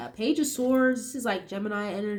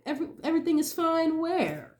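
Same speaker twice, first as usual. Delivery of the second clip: higher mocking everything is fine; sarcastic disbelief; 6 semitones higher than usual. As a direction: scoff at the claim. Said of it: "fake everything is fine energy".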